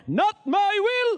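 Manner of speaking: high, loud, slow prayer line. Shown as a demonstration. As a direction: cry it out slowly to heaven. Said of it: "Sasabihin ko mula ngayon".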